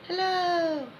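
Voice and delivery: high, drawn-out greeting; called-out hello. Shown as a demonstration. Coach showing students the hello they heard, called out. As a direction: float the word up high and hold it. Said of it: "heard yourself say hello".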